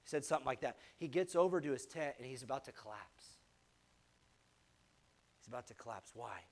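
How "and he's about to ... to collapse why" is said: dropped soft and low, as if worn out; hushed. Acted. Pastor hushing the room over an exhausted Jesus, soft and low. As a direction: sink the voice down like exhaustion.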